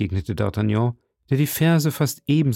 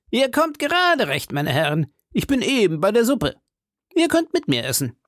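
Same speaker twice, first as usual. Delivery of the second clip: high, put-on character voice; hearty musketeer host; 11 semitones higher than usual. Host invites arriving friends to eat, high and hearty. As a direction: welcome them in a high, hearty voice. Porthos voiced in story dialogue.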